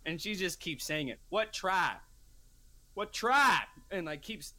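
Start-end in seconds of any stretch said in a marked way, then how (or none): 1.3-3.7 mock old lady repeating, building louder; getting louder. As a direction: push the second "What tribe" harder. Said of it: "like, louder".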